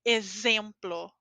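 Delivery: exemplo with a buzzing Z for the X; slow exemplo, teeth buzzing. Shown as a demonstration. Teacher demonstrating X as Z. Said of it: "como o dente vibrando".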